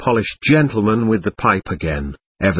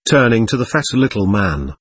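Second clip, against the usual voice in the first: ordinary delivery, nothing marked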